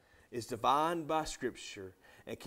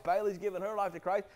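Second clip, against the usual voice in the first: usual speaking voice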